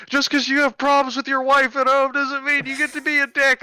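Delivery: very high-pitched imitation of a screaming kid; mock screaming. Restrained kid screaming at the officer, mock outraged. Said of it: "he's screaming at me".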